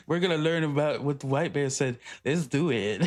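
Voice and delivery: put-on Brazilian accent; host announcing in a mock accent; hammed-up accented presenter; Guga. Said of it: "they gotta sound like Guga".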